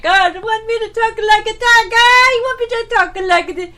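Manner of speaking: loud, high, hammed-up Mexican accent; badly faked Mexican accent. Joke teller acts out rejected applicant, bad Mexican accent. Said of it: "I can't do accents very well".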